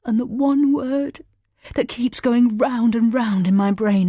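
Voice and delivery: high, loud anguished cry. A narrator's reading. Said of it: "gave an anguished howl".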